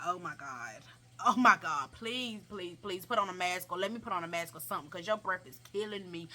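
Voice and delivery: low, soft mock plea; acted desperate aside; muted pleading. Inner plea against bad breath.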